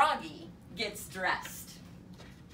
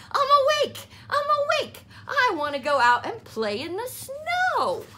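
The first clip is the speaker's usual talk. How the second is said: high, loud little-frog voice; Froggy insisting to mother; insistent Froggy; 7 dB louder, faster than usual. A narrator's reading. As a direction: insist like a wide-awake child. Froggy insists he's awake.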